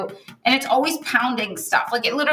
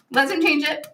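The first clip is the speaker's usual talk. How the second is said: ordinary talk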